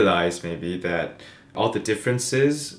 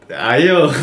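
excited cheer; 6 semitones higher, 10 dB louder than usual. Real, not acted. Cheers at the saying.

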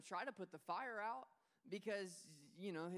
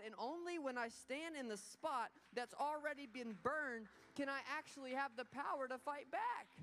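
fiery preaching; 5 semitones higher than usual. Acted. Pastor preaching worth to the congregation, fiery. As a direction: preach it with fire.

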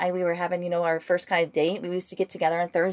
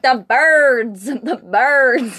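louder, higher mimicked insistence; insistent correction; 4 semitones higher, 7 dB louder, much slower than usual. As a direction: imitate someone loudly correcting a listener. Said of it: "he's like".

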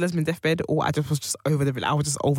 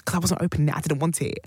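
usual speaking voice

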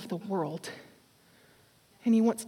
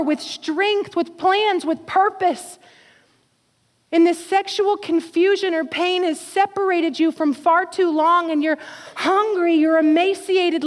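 higher, louder preaching build; impassioned preaching; 7 semitones higher, 7 dB louder than usual. Acted. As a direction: pour it out over the congregation. Preaching at full force.

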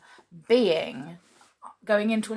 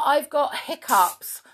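imitating daughter; 7 semitones higher, 4 dB louder than usual. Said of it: "she said to her".

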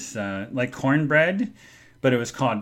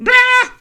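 high loud scream; startled scream; 12 dB louder than usual. Retold or imitated. Driver nearly hit in traffic, high scream. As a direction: scream as the car nearly hits. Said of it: "I was like".

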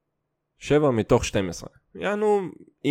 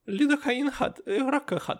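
pharmacist lady; 13 semitones higher, 3 dB quieter than usual. Retold or imitated. Pharmacist answers the kids.

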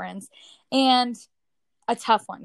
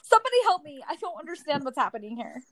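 high, rushed plea that drops off; mock panic; 6 semitones higher than usual. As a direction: playfully act out a helpless panic. Acting out her panic as new mother.

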